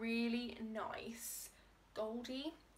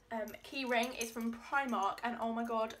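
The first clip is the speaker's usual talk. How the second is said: ordinary delivery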